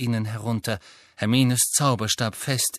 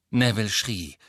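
ordinary delivery

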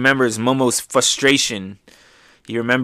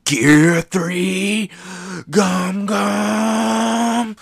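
battle cry; 10 semitones higher, much slower than usual. Retold or imitated. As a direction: call the move like a hero. Luffy powering up attack.

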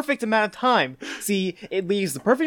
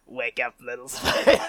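laughing Green Goblin; 3 semitones higher than usual. Retold or imitated. Podcast host attempting the Goblin line, laughing. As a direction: try the villain voice and crack up. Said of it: "someone give me a line".

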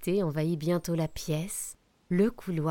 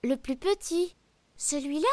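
high cookie voice; 7 semitones higher than usual. Acted. Gingerbread man answers mouse.

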